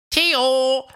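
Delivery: loud high call across the distance. A narrator's reading. Fred calls for Theo at his cave, loud. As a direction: shout for your friend. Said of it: "um nach Theo zu sehen".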